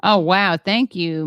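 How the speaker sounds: ordinary delivery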